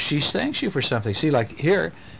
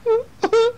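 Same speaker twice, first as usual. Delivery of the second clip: laugh; 5 dB louder than usual. Real, not acted. Laughs after his own voice.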